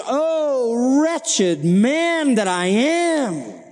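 long, high wail of distress; anguished wail. Acted. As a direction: cry it out in frustration, drawing each word out. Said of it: "cries out in the distress and the frustration".

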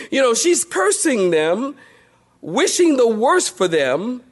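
ordinary talk

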